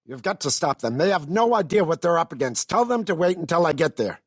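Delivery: high, forceful frantic order; urgent alarm. Acted. Ordering someone to hold the others back, urgent alarm. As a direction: raise the voice high and demand they stop.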